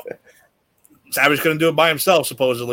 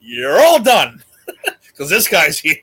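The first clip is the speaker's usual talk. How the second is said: mock dismissal; 2 semitones higher, 6 dB louder, slower than usual. Imagined boss to the whole locker room, loud mock dismissal. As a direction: stretch it out like a hammed-up sentencing.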